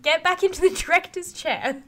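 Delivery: high, loud, exasperated order; ragey command. Real, not acted. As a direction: send him packing in a worked-up rant. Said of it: "Ragey tangent".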